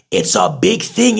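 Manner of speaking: ordinary talk, nothing marked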